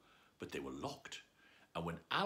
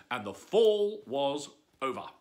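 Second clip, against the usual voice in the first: usual speaking voice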